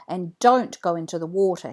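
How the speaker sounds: usual speaking voice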